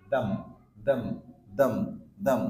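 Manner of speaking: THEM as a very short "dhum"; weak form. Shown as a demonstration. Teacher drilling tongue placement in "them", weak form. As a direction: say "them" with tongue tip at the teeth and reduced vowel. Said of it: "জিহ্বার আগার সামনের দাঁতের".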